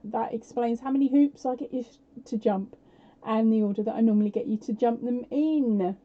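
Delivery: normal talking voice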